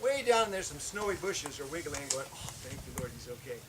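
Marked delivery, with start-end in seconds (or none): 2.3-3.6 hushed relief. Retold or imitated. Relieved private prayer.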